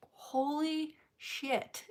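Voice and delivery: slow, high, soft disbelief; retold thunderstruck murmur; stunned mock awe. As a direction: stretch it out as if frozen in shock. Stunned reaction to the hug.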